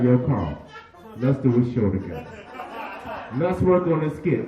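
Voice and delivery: GT's voice. Retold or imitated. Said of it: "G T would still be like".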